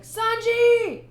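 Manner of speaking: high, loud scream of a name; panicked cry. Acted. Cook crying out as Sanji goes over, high loud scream. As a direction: scream for the boy overboard. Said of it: "the one cook's like".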